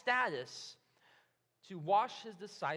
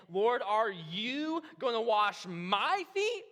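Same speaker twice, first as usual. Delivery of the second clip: "you" and "my" drawn out slow and louder; stress on pronouns; 7 dB louder, much slower than usual. Acted. Simon to Jesus as he washes feet, stressed pronouns. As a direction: lean hard and incredulously on "you" and "my". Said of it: "the Greek emphasizes the pronouns here".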